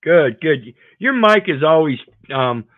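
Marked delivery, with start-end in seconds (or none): none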